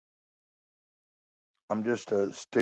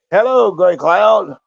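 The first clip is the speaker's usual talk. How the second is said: loud greeting; 9 semitones higher, 11 dB louder, slower than usual. Real, not acted. Greeting a chatter.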